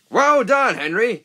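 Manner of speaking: high, loud cheer; delighted praise. Acted. Driver praising Henry, delighted. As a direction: cheer him on.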